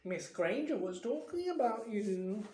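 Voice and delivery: mischievous telltale voice; sly poltergeist. A narrator's reading. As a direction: slip it in teasingly. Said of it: "said Peeves shyly".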